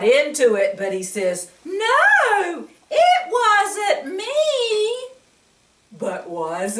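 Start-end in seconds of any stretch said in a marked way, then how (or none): 1.6-5.1 put-on high, slow, whining little boy; whiny denial. Reader voicing David denying he ate cake, whining. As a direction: wail it like a caught child.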